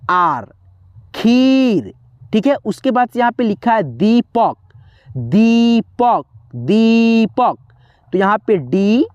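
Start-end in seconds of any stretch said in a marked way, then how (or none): 1.1-1.9 खीर with a long held ee; खीर said as kheeer; stretched long ee. Shown as a demonstration. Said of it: "धीरा नहीं है". 5.1-7.6 दीपक with दी drawn out slowly; दीपक said slowly as deee-pak; stretched long ee. Shown as a demonstration. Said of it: "खींच करके आवाज़ आया".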